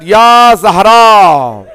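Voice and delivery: a slow, loud wailing call stretched long on "Ya"; crying out loudly. A narrator's reading. Ali mourning Fatima at her body, wailing cry. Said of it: "started crying loudly".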